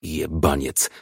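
muttered. A narrator's reading. Commissioner cursing after the suspect has left, muttered. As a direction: mutter it under your breath. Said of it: "mruknął".

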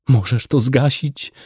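usual speaking voice

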